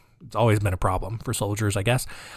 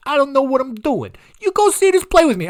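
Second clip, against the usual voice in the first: much higher, louder put-on Trump voice; deliberately bad Trump voice, pitched way up; bad Donald Trump impression; 12 semitones higher, 6 dB louder than usual. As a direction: push a clumsy mock Trump invite. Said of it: "This is a baddie Trump impression".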